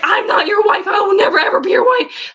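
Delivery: high-pitched, insistent mock outrage; retold pissed-off protest. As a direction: re-enact an indignant refusal, pitch climbing. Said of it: "I was just pissed off".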